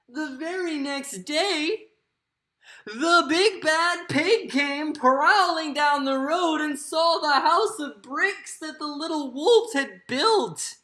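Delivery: high, loud, frightened narration; hammed-up scared storyteller; very afraid. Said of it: "very afraid just now".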